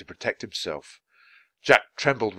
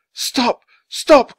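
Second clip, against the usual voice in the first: high, loud shouts, the second louder; desperate cry; 13 dB louder than usual. A narrator's reading. Man begging the ghost to end the vision, crying out. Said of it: "cried Charles".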